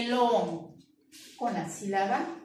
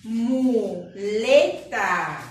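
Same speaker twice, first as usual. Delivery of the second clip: Muleta drawn out slowly, syllable by syllable, louder; slow sounding out; 6 dB louder, much slower than usual. Shown as a demonstration. Teacher sounding out a word for children, slow and loud. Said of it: "Con la sílaba mo".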